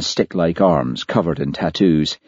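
usual speaking voice

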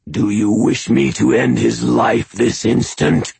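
loud, inhuman menace; threatening monster. A narrator's reading. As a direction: threaten the hostage's life. Skinwalker threatens hostage.

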